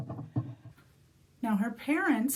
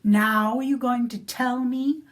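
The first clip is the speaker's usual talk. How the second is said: louder, slow, drawn-out menace; loud menacing demand; 6 dB louder, slower than usual. Acted. Molly lifting the leprechaun out, slow loud demand. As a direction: lean on every word, slow and threatening. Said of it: "and said".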